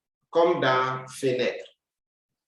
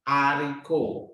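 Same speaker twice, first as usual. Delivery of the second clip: ordinary delivery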